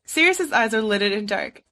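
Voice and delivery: ordinary delivery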